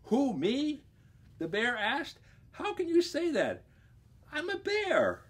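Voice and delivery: very high, astonished little voice; squeaky protest. Acted. Bear protesting to the vice president in a very high squeak. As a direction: squeak out your innocence.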